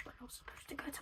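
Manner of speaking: fast conspiratorial whisper. Real, not acted. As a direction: let them in on a secret. Secret aside to viewers.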